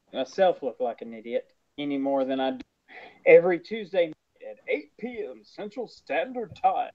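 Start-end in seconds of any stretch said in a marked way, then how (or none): 3.3-6.9 far-from-natural retro radio announcer, playful; seventies radio voice. Pretend. Co-host plugging the weekly show's air time, seventies radio voice. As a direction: put on an old seventies radio DJ voice. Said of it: "I had to use that seventies radio voice".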